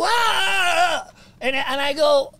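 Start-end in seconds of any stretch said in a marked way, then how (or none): 0.0-1.1 high, loud startled cry; startled yelp. Retold or imitated. Grabbed by [name] backstage, a loud startled yelp. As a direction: yelp like you've just been grabbed.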